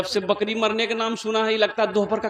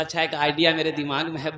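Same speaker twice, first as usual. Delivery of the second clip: sly husband; 8 semitones lower, 6 dB quieter, faster than usual. Acted. Husband's reply, voiced low.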